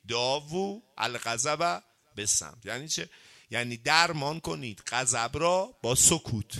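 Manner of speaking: normal talking voice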